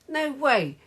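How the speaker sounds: high, emphatic shout; shouted denial. A narrator's reading. Margaret disputing the call, high shout. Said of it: "shouted Margaret".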